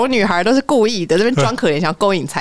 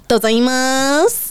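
flat squashed shop-clerk voice; duck voice; 4 semitones higher than usual. Shown as a demonstration. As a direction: press the voice flat like a Japanese clerk. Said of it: "一定要很，很扁，鸭子音".

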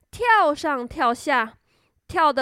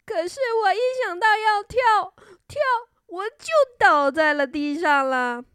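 high, dejected start sinking back down; a mild, discouraged sigh in the voice; sighing kangaroo; 6 semitones higher than usual. A narrator's reading. Kangaroo thinks about jumping, mildly sighing. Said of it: "袋鼠却叹着气说".

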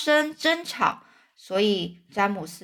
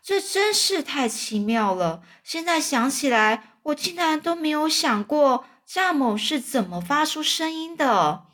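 put-on boy's amazed, higher-pitched exclamation; excited cry; 6 semitones higher than usual. A narrator's reading. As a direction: marvel aloud, pitch climbing. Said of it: "詹姆斯大叫起来说". (小男孩惊奇地高声叫道)

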